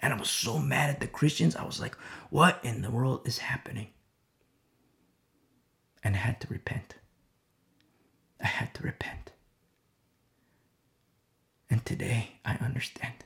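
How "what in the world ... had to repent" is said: whispered, almost breathless confession; hushed whisper. Real, not acted. Preacher confesses his anger to the congregation, whispering. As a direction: drop to a whisper of remorse.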